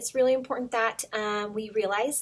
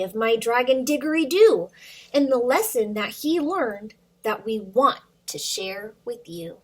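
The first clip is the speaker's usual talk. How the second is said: put-on lively storybook read-aloud for little kids; reading to children; 3 semitones higher, 4 dB louder than usual. Teacher reads a picture book to her students, lively and animated. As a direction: bring the picture book to life for the class.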